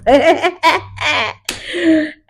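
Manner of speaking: high, long laugh; amused cackle. Real, not acted. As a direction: crack up at the love story. Laughs at the romance.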